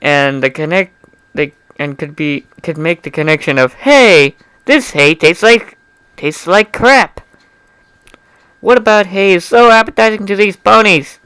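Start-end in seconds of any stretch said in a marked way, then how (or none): 3.7-11.2 can't believe the taste; incredulous. Acted. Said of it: "I asked myself incredulously".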